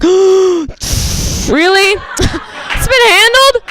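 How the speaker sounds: high, loud, stretched-out delighted gasp; thrilled disbelief. Retold or imitated. Wife swooning at her husband's tough-guy news, high excited.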